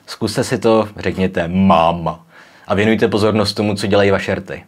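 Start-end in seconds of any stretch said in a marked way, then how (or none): 1.5-2.3 máma drawn out, lips pressed together on each M; bilabial M. Shown as a demonstration. Teacher asks learners to watch their lips, bilabial M in máma. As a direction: slowly model máma with a held, two-lip M. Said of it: "stiskneme horní a spodní red".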